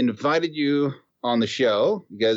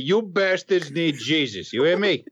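fiery minister; 7 semitones higher than usual. Acted. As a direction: scold the flock like a hammed-up minister. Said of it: "Ministers came to Chalmette and said".

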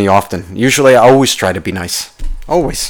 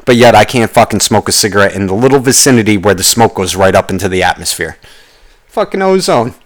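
ordinary talk

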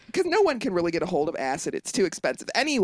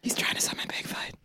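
whisper; 7 dB quieter than usual. Retold or imitated. Echoing spirit whisper.

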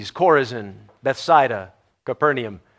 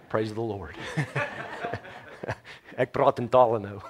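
laughing; 4 semitones lower, 4 dB quieter than usual. Real, not acted. Laughing at his own Afrikaans.

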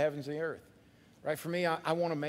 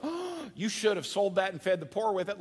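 indignant scolding; 3 semitones higher than usual. Retold or imitated. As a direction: scold her for wasting it. Disciples scolding the woman.

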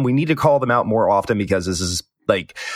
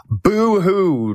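high mocking boo-hoo; fake crying; 9 semitones higher than usual. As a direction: sneer out a fake sob. Mocking druid players' complaint.

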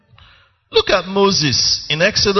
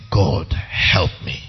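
slow, breathy whispered plea; broken plea; 10 semitones lower, slower than usual. Retold or imitated. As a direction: whisper it as if worn out by tears. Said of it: "you are just crying and saying".

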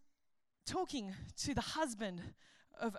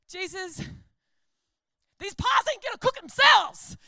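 loud, high, sassy complaint; sassy Martha; 6 semitones higher, 13 dB louder than usual. Retold or imitated. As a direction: snap at Jesus like a fed-up hostess. Martha scolds Jesus, joke.

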